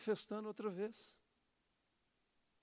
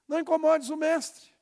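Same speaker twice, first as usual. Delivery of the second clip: loud, high mocking taunt of the enemy; 8 semitones higher, 10 dB louder than usual. Preacher voicing the enemy of our dreams, loud mocking taunt. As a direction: sneer it like a voice whispering doubt. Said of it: "Ao inimigo dos nossos sonhos".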